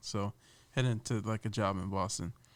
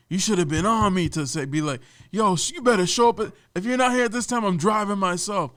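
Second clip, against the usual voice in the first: mock scolding; 8 semitones higher, 8 dB louder than usual. Tunde's scolding retold.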